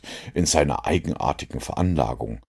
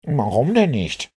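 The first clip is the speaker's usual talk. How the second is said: high-pitched, puzzled challenge; surprised, slightly annoyed; 8 semitones higher than usual. A narrator's reading. Inspector challenges Holmes for not checking, surprised and slightly annoyed. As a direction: ask it in surprise with a touch of irritation. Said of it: "blickte überrascht und etwas ärgerlich auf".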